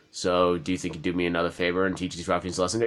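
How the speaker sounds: low, soft and fast favor request; quick quiet NPC. Acted. Lightly voiced NPC asking the player to fight ruffians, soft and quick. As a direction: rattle it off softly, low in the voice.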